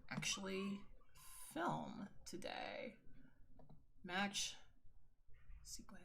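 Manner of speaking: whispered to herself while working; barely-voiced mutter as she clicks through the edit. Real, not acted. Vlogger whispers to herself while editing, whispering.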